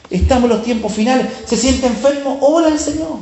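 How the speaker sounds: rising pitch on each call to pray; preaching exhortation. Acted. Preacher urging brothers to pray, rising fervor.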